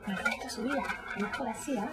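low, soft, hurried Spanish; hushed dosing. Acted. Giving the girl another pill, hushed and low.

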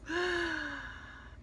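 high soft gasp of delight. Real, not acted. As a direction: gasp like you just pictured the party. Excited about Christmas parties.

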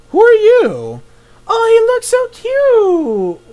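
very high, drawn-out cooing; cute-struck squeal. Real, not acted. Player meeting a little mouse, high gushing squeal. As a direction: squeal as if at a tiny pet.

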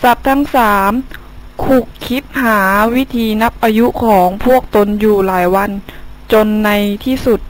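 steady fable narration; story narration. A narrator's reading. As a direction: tell the fable to children.

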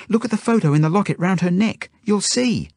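urgent, high-pitched pleading; frantic. A narrator's reading. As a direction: beg the captor to check the proof. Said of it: "I say frantically to the woman".

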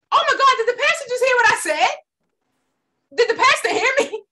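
high, startled disbelief; shocked. Retold or imitated. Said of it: "I was like".

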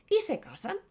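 ordinary talk

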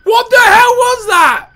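high, loud startled shout; shocked yell. Real, not acted. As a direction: blurt it out in shock. Jump scare shock.

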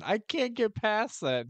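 ordinary talk, nothing marked

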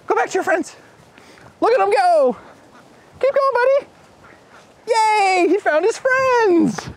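high bright cheering; root for the little duck. Real, not acted. Host cheering a duck back to its flock.

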